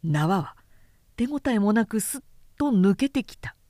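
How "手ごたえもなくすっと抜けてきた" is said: ordinary delivery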